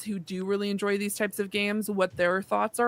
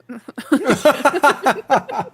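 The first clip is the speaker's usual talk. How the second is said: high, loud burst of laughter; 6 semitones higher, 8 dB louder than usual. Real, not acted. Podcast host cracking up at the half point, high loud laugh.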